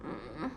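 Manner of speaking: very low, soft sad mm; pouting whimper. Shown as a demonstration. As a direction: whimper with a pout, pretending. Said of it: "I am pouting".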